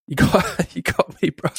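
breathy laugh through real tears; choked up. Real, not acted. Host moved by the dog video, choked up. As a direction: caught off guard, fighting emotion. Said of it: "now I'm getting emotional".

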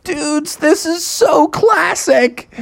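very high, slow, stretched words; high-pitched gleeful drawl; thrilled bear buddies. Acted. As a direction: gush like stoked cartoon dudes. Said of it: "Bubby and his friends were like".

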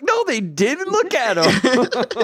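high, slow mocking retort. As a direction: scoff at the announcer. Mocking the announcer.

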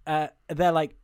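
ordinary delivery

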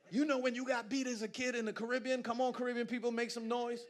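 loud, fast, laughing preaching; shouting preacher. Acted. Preacher riling up Caribbean people, loud and laughing. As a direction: whip the crowd up, loud. Excited preaching about beatings.

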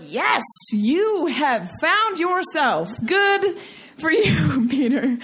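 slow, drawn-out over-the-top praise; effusive affirmation. Retold or imitated. Relative praising Peter for snapping, effusive affirmation. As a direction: affirm him with stretched, exaggerated approval.

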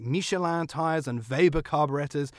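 Michelin said as "Mee-shuh-lan", Weber as "Vay-ber"; French "Michelin", German v in "Weber"; native pronunciation. Shown as a demonstration. Host mocks pretentious names, Michelin and Weber said the native way. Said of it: "intended in their native tongue".

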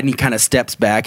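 ordinary talk, nothing marked

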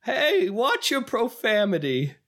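high, slow mock scolding; TV-clip impression. Guy in TV show clip, high drawn-out scolding. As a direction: stretch out a high-pitched reprimand. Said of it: "a guy saying".

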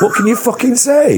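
high-pitched exasperated appeal. Real, not acted. Telling co-host about an unwelcome impersonation, exasperated. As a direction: throw it out in helpless indignation. Recalls being annoyed at flattery.

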